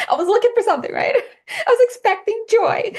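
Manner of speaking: high, loud, laughing through it. Real, not acted. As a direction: laugh while confessing. Laughs at her own coffee story.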